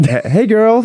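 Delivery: put-on high, bright American girl greeting; American accent. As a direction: gush it like an American bestie. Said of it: "Don't know why I'm American all of a sudden".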